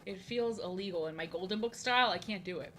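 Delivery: ordinary delivery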